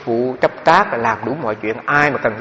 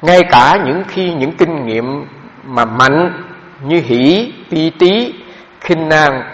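slow, measured reading of a dharma passage, louder at the start; formal text reading; 4 dB louder, much slower than usual. Acted. The teacher reads a meditation text aloud to the assembly, slow and measured. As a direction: read every word deliberately, as if from the book.

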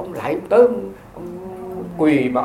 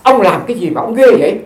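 acted astonished mother, loud and high; astonished outburst; 5 semitones higher, 9 dB louder than usual. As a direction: burst out, unable to hold the question back. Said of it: "bà hết chịu không nổi rồi".

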